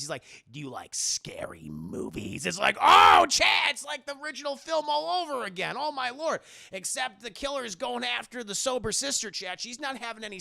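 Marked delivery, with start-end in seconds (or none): 0.5-2.4 low, slow whispery phone villain; creepy killer. Retold or imitated. Killer taunting her by phone, creepy. 2.8-6.4 high, loud hammed-up exclamation; mock amazement. Streamer marvelling to chat at the repeat, overexcited.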